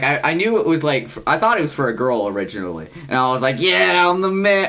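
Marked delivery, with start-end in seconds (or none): 3.6-4.7 loud, high, drawn-out cheer; mock triumph. Retelling his reaction to a girl wanting him, mock triumphant cheer. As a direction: crow it like a self-satisfied winner.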